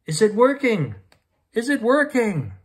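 lightly acted yell, pitched high; mother's yell. A narrator's reading. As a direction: call anxiously through a closed door. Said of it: "stood outside the door and yelled".

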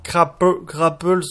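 Grapple said with a heavy French accent; French accent English. Real, not acted. YouTuber stumbling over the English mod name, French accent. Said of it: "toujours mon accent anglais pourri".

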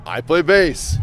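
loud, overprojected ad line; mock commercial pitch. Band member pitches the band in a fake ad, loudly hammed-up. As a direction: declare it like an amateur ad actor.

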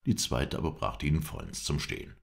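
plain reading voice

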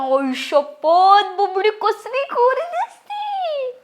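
slow, drawn-out cooing, climbing high; drawling admiration that rises to the end; gushing amazement. Acted. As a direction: stretch every word in mock wonder. Gushing over the child's looks.

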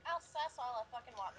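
ordinary talk, nothing marked

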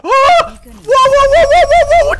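high, loud run of whoas; rapid-fire panicked whoas; startled yelling. Real, not acted. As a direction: yelp in alarm, over and over. Startled by sudden enemy in game.

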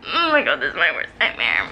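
ordinary talk

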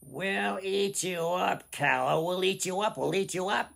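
high, loud goblin chant; menacing goblins taunting their prey; goblins shrieking. Acted. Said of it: "the goblins shrieked".

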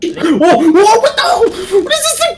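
high, laughing startled yell; laughing surprised shout. Real, not acted. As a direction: shriek and laugh at once. Surprised by a monster.

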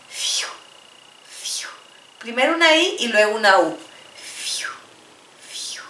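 soft blown phew; whispered relief sound. Shown as a demonstration. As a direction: exhale it like relief. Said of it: "in English".

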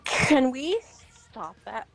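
usual speaking voice

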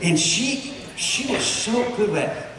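usual speaking voice

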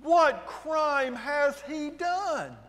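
high, very slow demand. A narrator's reading. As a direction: draw out each word in protest. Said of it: "Pilate demanded".

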